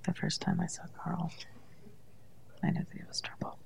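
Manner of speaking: unvoiced whisper, barely moving mouth. Shown as a demonstration. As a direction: whisper it with your lips almost still. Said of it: "Just have to whisper".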